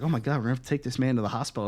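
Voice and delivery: rattled off very fast; fast panicked rush. Retold or imitated. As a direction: blurt it out in a hurry. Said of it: "I was like".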